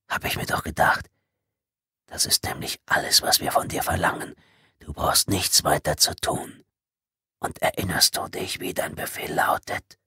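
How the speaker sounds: toneless whisper, unhurried and menacing; hissed whisper. Acted. Intruder reminds Tommy of his orders, whispered. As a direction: whisper the threat right into his ear. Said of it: "zischte die erste Stimme".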